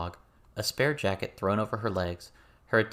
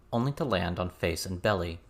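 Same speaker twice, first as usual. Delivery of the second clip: ordinary delivery, nothing marked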